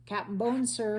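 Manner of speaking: calling out loud to the captain; shouted. A narrator's reading. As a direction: hail the captain through the window. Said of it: "he shouted".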